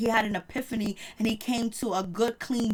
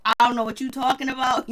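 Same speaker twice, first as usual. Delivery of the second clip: ordinary delivery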